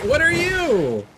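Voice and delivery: ordinary talk, nothing marked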